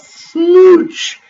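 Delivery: high, loud, drawn-out smooch; exaggerated kiss word. A narrator's reading. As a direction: stretch it out like a big kiss. Narrator acts out a big kiss.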